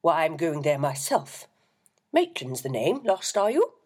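a jovial booming vicar; hearty reverend. A narrator's reading. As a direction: reverend greeting a lost boy, hearty and loud. The booming reverend introduces himself.

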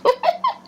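sharp high laugh; short high cackle. A narrator's reading. Second foot laughing at her, sharp high laugh. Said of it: "the other laughed".

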